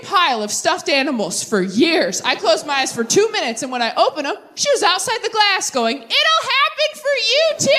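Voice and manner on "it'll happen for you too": very high, cheery pity; chirpy pity. Retold or imitated. Engaged friend cheering from outside the glass, very high and chirpy. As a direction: chirp it sweetly from afar.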